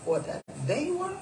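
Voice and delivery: expressive read-aloud storytelling; animated story reading voice; story narration. A narrator's reading. Reads her story aloud.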